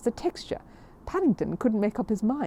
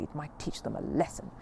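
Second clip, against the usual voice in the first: lower, softer grim warning; darkly muttered; 4 semitones lower, 6 dB quieter than usual. Acted. Mrs Bird on the fair-weather sponsors, low and dark. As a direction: let it fall darkly, half to herself. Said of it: "said Missus Bird darkly".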